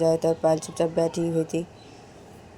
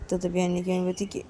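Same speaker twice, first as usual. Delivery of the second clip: ordinary delivery, nothing marked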